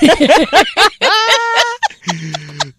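a loud, high burst of laughter. Real, not acted. Host bursting out at the Ludhiana answer, loud laugh. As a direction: crack up hard, loud and high.

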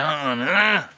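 ordinary talk, nothing marked

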